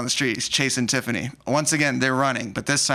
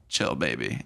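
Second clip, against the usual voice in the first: low, soft brush-off; dismissive boyfriend, low and hushed; Pat's cool brush-off; 12 semitones lower, 6 dB quieter, slower than usual. Retold or imitated. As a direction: brush her off coolly, low and quiet. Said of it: "And then he goes".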